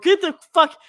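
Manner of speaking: mock yell. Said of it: "I'm like".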